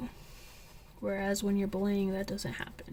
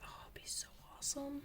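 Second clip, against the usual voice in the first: soft, higher, breathless whisper of delight; gushing whisper; 5 semitones higher, 14 dB quieter than usual. Retold or imitated. Retelling her hopes about a climbing buddy, excited whisper. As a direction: gush it under your breath like a secret thrill. Imagining climbing with new friend.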